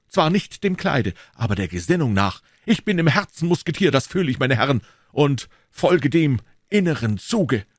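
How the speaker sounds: put-on earnest young swordsman, pitched high; D'Artagnan.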